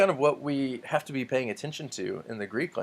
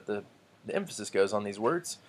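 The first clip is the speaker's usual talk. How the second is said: usual speaking voice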